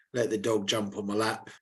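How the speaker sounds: ordinary delivery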